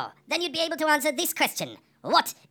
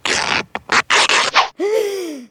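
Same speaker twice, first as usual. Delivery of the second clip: amazed gasp; 5 semitones higher, 6 dB louder than usual. Acted. Sees the stripper naked.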